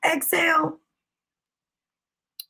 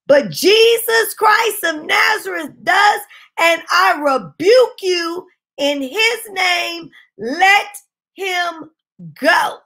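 raised, emphatic command casting out a demon; forceful rebuke; 4 semitones higher, 4 dB louder, slower than usual. Retold or imitated. Preacher commanding a demon to let the boy go, forceful rebuke. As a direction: drive each phrase out with loud authority.

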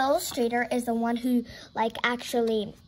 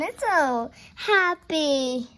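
slow, higher, drawn-out coo; cooing delight; 4 semitones higher, 4 dB louder, much slower than usual. Real, not acted. Reader admires the kitten picture, slow cooing voice. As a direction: gush over a cute kitten.

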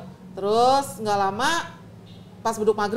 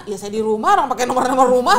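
much louder, fast defensive comeback; loud retort; 3 semitones higher, 10 dB louder, faster than usual. Retold or imitated. Wife shouting back at her husband on the phone.

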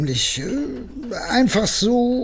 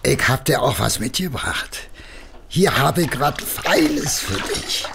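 much lower, soft sweet-talking; coaxing wife; 8 semitones lower, 3 dB quieter than usual. Acted. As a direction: win her over gently. Husband soothes angry wife.

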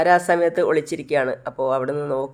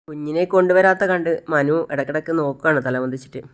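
plain reading voice

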